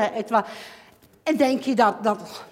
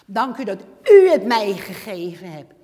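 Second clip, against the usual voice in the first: louder, laughing "Dank u dat u het mij gegeven hebt"; joyful loud thanks; 7 dB louder than usual. Shown as a demonstration. Believer thanking God, joyful and loud with a laugh. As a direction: burst out in happy gratitude. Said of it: "Hoor je wat ik zeg?".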